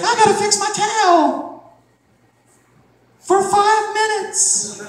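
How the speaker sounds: put-on high, drawn-out girly voice. Comic imitating a beach girl fussing with her towel, high and slow.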